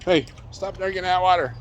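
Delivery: high-pitched, teasing scold at the pup; mock scolding the dog. Real, not acted. Owner teasing the dog at the water, high mock scold.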